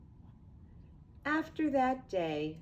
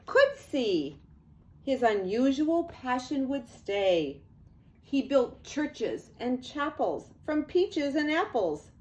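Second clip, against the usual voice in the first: lively read-aloud; 3 semitones higher, 5 dB louder than usual. A narrator's reading. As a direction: read it like an excited storyteller to kids. Animated storybook narration.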